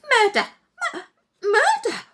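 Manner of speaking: high, loud stammered shriek; woman in the crowd shrieking murder, high and loud; shrieking alarm. Acted. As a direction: cry murder in panic.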